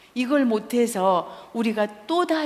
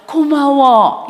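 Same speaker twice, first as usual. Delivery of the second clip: loud drawn-out thanks; 5 semitones higher, 13 dB louder, much slower than usual. Shown as a demonstration. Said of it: "이렇게 하면 되잖아".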